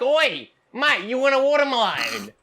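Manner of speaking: put-on Aussie accent calling up to strangers. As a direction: shout up like a cheeky Aussie bloke. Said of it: "every person you impersonate have an Aussie".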